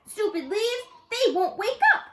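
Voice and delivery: high, loud, annoyed outburst; frustrated. A narrator's reading. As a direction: stomp and complain. Camilla frowning, frustrated.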